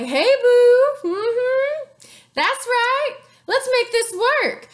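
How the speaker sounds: high, flirty sweet-talk; playful high come-on voice; Caesar flirting. Retold or imitated.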